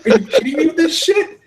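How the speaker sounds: high, incredulous rant at the trolls; are you kidding me, cranked way up; exasperated outburst. Retold or imitated. Venting to co-hosts about Twitter trolls, high exasperated disbelief.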